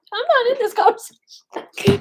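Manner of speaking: high, slow, put-on voice of a friend dodging the topic; mock friend's protest. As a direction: tease her by playing her innocent denial up high. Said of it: "She's like".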